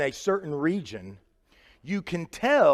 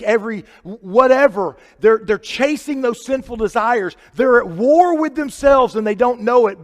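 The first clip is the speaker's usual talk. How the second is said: raised, driving sermon delivery; higher-pitched, urgent pulpit voice; impassioned preaching; 8 semitones higher, 8 dB louder than usual. Real, not acted. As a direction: preach it hard, louder on key words. Preaches at full force.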